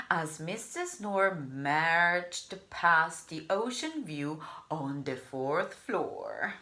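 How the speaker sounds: plain reading voice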